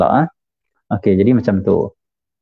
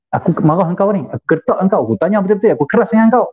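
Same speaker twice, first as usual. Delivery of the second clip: high-pitched stern confrontation; imitating a man harshly pressing someone; raised voice, serious; 7 semitones higher than usual. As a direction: build higher with each demand. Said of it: "Waktu tu juga suara dia tinggi".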